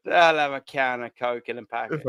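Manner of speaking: low-pitched, unfinished stab at Scouse; bad Scouse accent. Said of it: "No, that's terrible".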